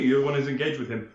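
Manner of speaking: ordinary delivery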